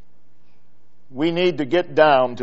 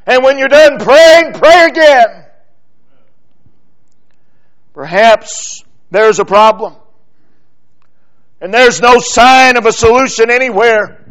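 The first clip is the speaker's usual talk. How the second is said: loud, higher, rising sermon climax with punched commands; full-force preaching; 7 semitones higher, 8 dB louder than usual. Acted. Preacher urging the congregation to pray without ceasing, at full preaching force. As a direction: preach it hard, hammering each "pray".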